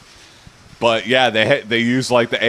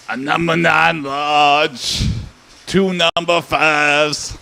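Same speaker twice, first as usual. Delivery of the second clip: slow, drawn-out gang member ordering at the drive-thru; Big Smoke; 2 semitones higher, much slower than usual. Retold or imitated. Quoting the game's famous drive-thru order, slow impression. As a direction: lay out the order slowly like a game character. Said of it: "if you order Big Smoke's order".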